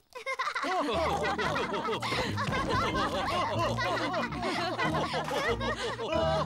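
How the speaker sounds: a long amused giggle. Acted. Peppa giggles at George's dinosaur tree idea. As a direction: keep giggling at the silly idea.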